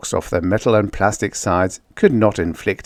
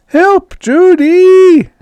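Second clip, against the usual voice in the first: high, loud scream for help; 11 dB louder, much slower than usual. Acted. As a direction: shriek her name in panic. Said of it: "Jane screamed again".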